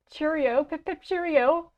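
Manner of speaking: high mock-British calibration phrase; attempted British accent. Shown as a demonstration. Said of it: "I don't think I could do a British accent".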